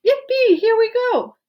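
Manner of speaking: high, loud whoop; joyful shout. A narrator's reading. Grandpa Joe cheering the rocket ride, high and loud. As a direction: cheer as they rise.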